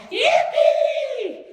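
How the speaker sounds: sudden explosion of joy, high and loud; jubilant yell. A narrator's reading. Grandpa Joe explodes with joy at the ticket, yelling. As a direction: whoop it out at the top of your voice. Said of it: "He threw up his arms and yelled".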